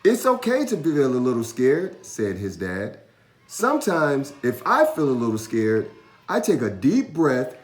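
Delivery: a hint of a comforting father; reassuring dad. A narrator's reading. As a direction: coax him kindly as his dad.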